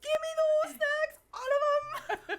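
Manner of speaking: high-pitched, very loud hammed-up scream; over-the-top greedy yell; mock frantic demand. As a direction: shriek it like a desperate snack raid. Acting out frantic snack run.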